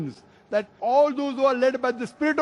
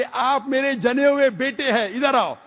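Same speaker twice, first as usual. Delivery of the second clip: a higher, mildly mocking elder's voice; mock elder claim; 6 semitones higher than usual. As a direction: play the old man claiming to be the real father. Elder's absurd literal claim.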